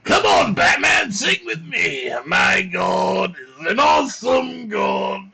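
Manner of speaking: manic high shouting villain; wild cackling Joker yell. Pretend. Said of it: "sing it like the Joker".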